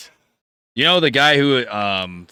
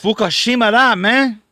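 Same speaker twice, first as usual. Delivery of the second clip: loud, high mock Japanese; fake foreign language; 12 semitones higher, 9 dB louder than usual. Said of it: "That's not Japanese".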